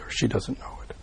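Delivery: soft whispered afterthought; whispered aside. Real, not acted. Teacher whispers a quick correction to his students.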